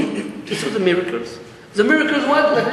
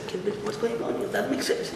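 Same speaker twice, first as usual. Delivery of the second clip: much softer, fast, hidden muttered aside; hushed disbelief; 3 semitones lower, 12 dB quieter than usual. Retold or imitated. Hidden king reacting to his wife's demand, hushed. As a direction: say it under your breath from behind the curtain. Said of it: "he said".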